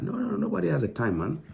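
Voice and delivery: nobody has time, rising in frustration; exasperated. Real, not acted. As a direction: throw up your hands at them. Exasperated rant at callers.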